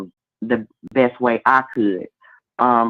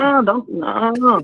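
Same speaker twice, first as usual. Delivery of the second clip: playful refusal; 10 semitones higher than usual. Acted.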